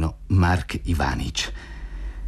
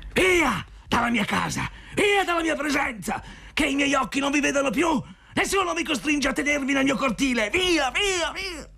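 high-pitched, furious banishing tirade; exasperated shouting. A narrator's reading. Zotov banishing horse and dog from his yard, exasperated screaming. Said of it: "gridò al colmo dell'esasperazione".

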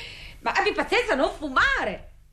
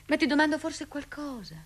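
ordinary talk

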